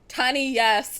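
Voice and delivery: loud, emphatic comeback on YES; defiant. Real, not acted. Gamer overruling warnings about taking a loan, defiant.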